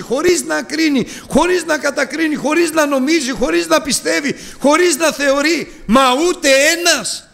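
high, ringing repetition; high fervent chant; impassioned preaching. Acted. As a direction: hammer each χωρίς. Rhetorical build.

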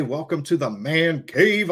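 ordinary delivery

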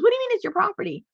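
high and fast, retelling his shock; animated outburst. Retold or imitated. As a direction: fire it off like a stunned man. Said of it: "then he really got animated".

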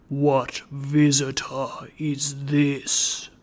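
slow goblin of the bell; low, deep voice. A narrator's reading. Goblin bell challenges an intruder, low and deep. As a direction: intone it slowly as a deep spectral bell. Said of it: "The voice was low and deep".

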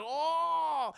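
long, high drawn-out ooh; mock awe, held long; hammed-up sound of discovery; amazed oh. Strange reacting to the discovery.

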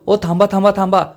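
mild narrated cry to stop; sudden cry. A narrator's reading. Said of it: "ती जोरात ओरडते". (हलकेच ओरडून थांबवणे)

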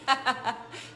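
short happy laugh; delighted laugh. Real, not acted. Host thrilled about music for her brain, delighted laugh. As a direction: laugh delightedly at your own point.

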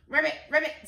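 two quick frog calls; frog ribbit. A narrator's reading. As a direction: croak it like a storybook frog. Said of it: "says Frog".

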